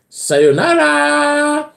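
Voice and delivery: very high, slow, stretched farewell; hammed-up YouTuber sign-off, way up high; drawn-out sing-song sayonara. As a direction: fling a long squealing goodbye like an anime fan. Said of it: "but it says".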